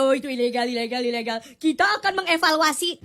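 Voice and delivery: low, dismissive mock official. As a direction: drone it out like a bored bureaucrat. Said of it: "Laginya kayak gini".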